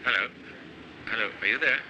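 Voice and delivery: ordinary talk, nothing marked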